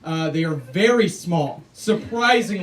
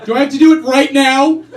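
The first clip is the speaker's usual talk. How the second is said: louder and higher, snapping impatiently; irritated customer; 5 semitones higher, 8 dB louder than usual. Retold or imitated. Comic brushing off the canvasser, loud and irritated. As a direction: shove them off with annoyed bluster. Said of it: "Be like".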